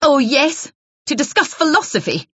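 high, mocking echo of her words; scornful sneer. Jealous wife mocks Isabel's excuse, scornful. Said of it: "Ros MacLeod snorted".

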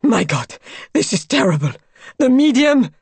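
horrified outburst, rising high and loud; horrified cry. A narrator's reading. Raoul fearing for the medium, crying out in horror. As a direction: cry out, building to alarm on the last words. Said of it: "cried Raoul".

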